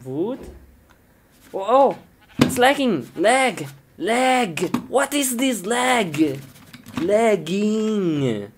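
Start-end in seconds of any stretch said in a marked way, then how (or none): 1.5-8.5 frustrated whine. Pretend. Game is lagging.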